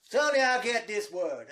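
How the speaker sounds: ordinary delivery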